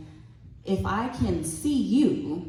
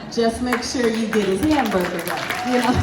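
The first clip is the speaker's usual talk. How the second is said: sassy; 5 semitones higher, 6 dB louder than usual. Pretend. Sassy aside to an impatient stranger.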